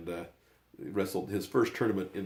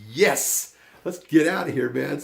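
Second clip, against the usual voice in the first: loud, raised, insistent urging; imitated impatience; 6 semitones higher, 8 dB louder, slower than usual. Son urging dad to leave for shoes, loud impatience. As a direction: push him to hurry, voice up.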